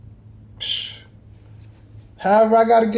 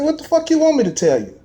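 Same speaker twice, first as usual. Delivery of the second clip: higher-pitched incredulous snap back; retold angry retort; 8 semitones higher than usual. Retelling how he answered his friend's challenge, heated. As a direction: fire it back at him, pitched high and outraged.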